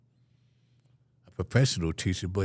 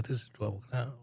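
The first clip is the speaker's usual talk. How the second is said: usual speaking voice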